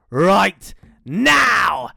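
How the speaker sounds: loud, stretched, swooping words; menacing reveal. Acted. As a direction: announce the trap with relish, each word apart. Dingo about to pounce.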